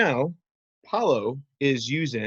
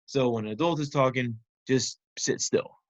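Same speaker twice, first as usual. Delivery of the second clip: ordinary talk, nothing marked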